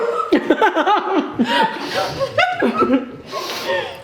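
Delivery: long high-pitched giggle; high laugh. Real, not acted. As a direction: laugh in triumph. Laughs after winning.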